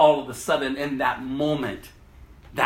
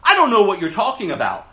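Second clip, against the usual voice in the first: denying; 5 semitones higher, 7 dB louder, faster than usual. Acted. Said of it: "But Peter denied it".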